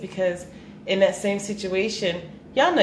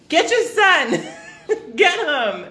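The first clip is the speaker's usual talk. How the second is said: high, laughing mock plea for help; 9 semitones higher, slower than usual. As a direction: retell the plea through laughter. Laughingly retelling her plea.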